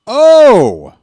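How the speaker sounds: shocked yelp. Real, not acted. Sudden roll reaction.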